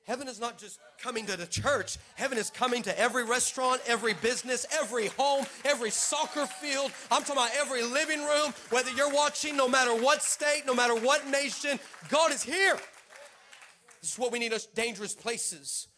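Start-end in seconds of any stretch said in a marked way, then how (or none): 2.2-12.8 rising louder and higher preacher build; preaching. Acted. Pastor declares heaven to every viewer, preaching louder.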